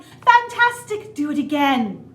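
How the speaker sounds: very loud, high, slow Fantastic; excited shout. A narrator's reading. Princess shouting praise to wear the dragon out, very loud. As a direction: cheer him on at full voice. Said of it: "Elizabeth shouted".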